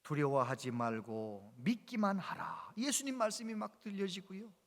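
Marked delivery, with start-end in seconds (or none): 0.0-2.7 low, slow solemn voice; lower, slow reassuring divine voice; scripture word. Retold or imitated. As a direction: speak it as God's calm word. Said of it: "이런 말씀을 주시더래요".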